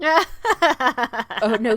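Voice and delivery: a hammed-up gasp of refusal; startled protest. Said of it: "And I was like".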